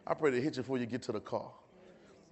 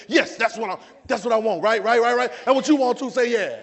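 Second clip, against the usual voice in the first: loud, raised, eager affirmation; full-force preaching; 9 semitones higher, 13 dB louder than usual. Real, not acted. Preacher rousing the congregation to say yeah, full-force preaching. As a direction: preach it up for a response.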